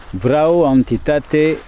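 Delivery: slow, syllable-by-syllable Romanian in a thick French accent; calling out to a spirit in clumsy French-accented Romanian; bad French-accented Romanian. Shown as a demonstration. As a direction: spell out each Romanian word in a heavy French accent. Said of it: "Avec mon accent de merde".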